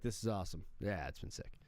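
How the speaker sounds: low, mumbled, laid-back approval; lightly voiced dad converted to soccer; imitating dad won over. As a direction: play the dad grudgingly hooked. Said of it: "he's like".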